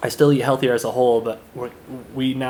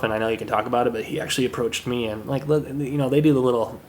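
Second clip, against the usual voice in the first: ordinary talk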